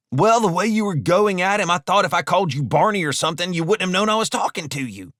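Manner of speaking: high-voiced bickering henchman; defensive robber. Robber defends himself in a quarrel.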